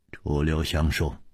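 ordinary delivery, nothing marked

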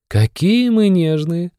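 high, slow, mocking sneer. A narrator's reading. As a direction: drawl it out teasingly, high. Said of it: "усмехнулась Эмма".

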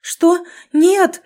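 high, loud scream of protest; furious scream. Acted. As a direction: scream it in horror. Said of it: "яростно заорала". (яростный крик)

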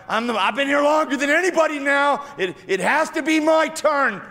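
the long-waiting man claims his turn; pleading lame man. Acted. Lame man by the pool speaks.

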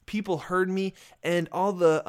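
usual speaking voice

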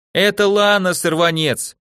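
loud high chant, near tears; shouted cheer. Acted. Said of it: "кричали сёстры сквозь наворачивающиеся слёзы".

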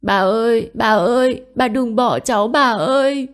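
put-on loud, very high child's wail; piercing grief-stricken girl's shriek; screaming cry. A narrator's reading. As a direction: cry out desperately for grandma. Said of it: "khóc thét".

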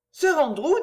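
a gentle noblewoman begging him not to worry; Marguerite's voice. Acted.

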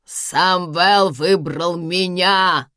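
loud, very slow triumphant yell; shouted. A narrator's reading. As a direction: stretch it out as a cry of victory. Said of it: "прокричала Карина". (громкий протяжный крик)

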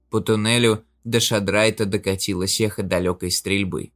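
plain reading voice

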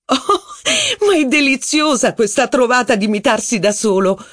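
very high, gushing squeal; bursting out in delight. A narrator's reading. Rachel cheers the dancer mocking himself, squealing with glee. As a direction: burst out thrilled, clapping along. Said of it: "proruppe Rachel battendo le mani".

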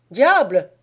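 loud mocking exclamation; sneering. A narrator's reading. Louarn jeers at the lovers from the doorway. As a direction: jeer at the couple. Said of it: "ricana-t-il".

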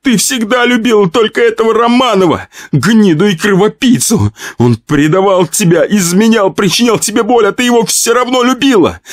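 high, wailing reproach; tearful tirade. Acted. Pyotr pouring out jealousy at his wife, crying. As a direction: rant in a high, hurt wail. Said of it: "заплакал Пётр".

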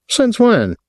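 pitched high, mildly angry challenge; a touch of indignant protest; getting angry. A narrator's reading. Captain challenging the hotel man, getting angry. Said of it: "Willie was getting angry now".

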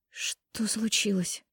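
slow, soft, sleep-hoarse murmur; sleepy hoarse mumble. A narrator's reading. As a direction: mumble it half asleep. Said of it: "хриплым со сна голосом пробормотала я".

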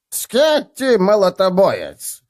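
chest puffed out, slow and high; proud. Acted. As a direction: announce your name with pride. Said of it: "выпитив грудь".

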